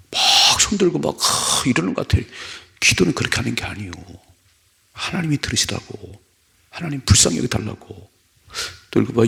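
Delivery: ordinary talk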